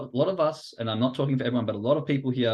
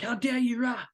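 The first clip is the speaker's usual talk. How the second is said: high-pitched indignant outburst, put on; mock snapping; 11 semitones higher than usual. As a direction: play at lashing out, ending in a mock roar. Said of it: "you just snap at them".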